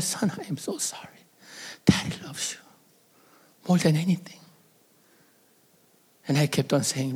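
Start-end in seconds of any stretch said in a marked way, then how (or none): 0.0-4.4 father holding his sobbing son, whispering; tender whisper. Retold or imitated. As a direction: comfort him in a hush.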